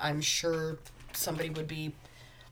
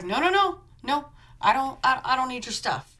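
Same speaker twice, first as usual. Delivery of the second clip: flustered refusal; 5 semitones higher, 5 dB louder than usual. Retold or imitated. Blonde refusing help, voiced.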